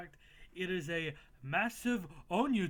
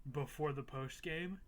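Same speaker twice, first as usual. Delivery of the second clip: usual speaking voice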